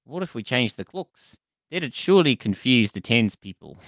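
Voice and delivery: a bad parody Kiwi accent. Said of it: "this is a terrible Kiwi accent".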